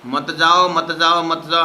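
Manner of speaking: loud, high pleading don't go; loud repeated pleading; urgent plea. Retold or imitated. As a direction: call out begging them to stay. Raj ji begging souls not go.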